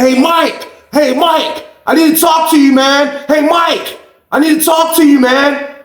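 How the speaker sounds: high yelled calls through a door; calling out. Retold or imitated. Man at the door shouting for Mike, high yelled call.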